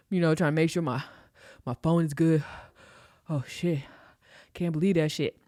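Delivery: acted puffing between phrases; out of breath. Man winded after jogging to car, out of breath. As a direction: play winded, breaking up the phrases. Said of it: "My dumb ass is out of breath".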